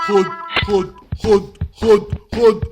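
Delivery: loud, angry shouting while hitting; furious waiter. Acted. Waiter catching runaway Hamada, shouting angrily. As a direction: yell as you catch him.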